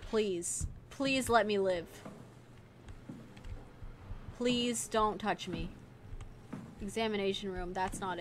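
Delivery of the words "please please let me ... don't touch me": quiet pleading. Real, not acted. Begging monster while sneaking.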